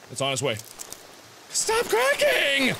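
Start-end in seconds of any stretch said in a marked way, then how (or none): 1.5-2.7 very high, slow, drawn-out plea; squealed complaint. Real, not acted. Fed up with noisy steps.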